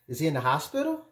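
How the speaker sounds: ordinary talk, nothing marked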